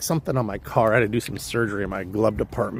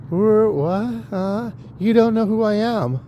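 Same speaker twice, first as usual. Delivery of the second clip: higher, bewildered groggy questions; confused waking; 9 semitones higher, slower than usual. Retold or imitated. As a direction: play the dazed man just waking up.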